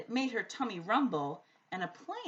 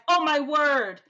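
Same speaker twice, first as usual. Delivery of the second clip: loud, exasperated king; king crying out; 2 semitones higher, 11 dB louder than usual. A narrator's reading. The king complains about the giant's noise. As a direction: cry out in dismay. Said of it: "The king cried".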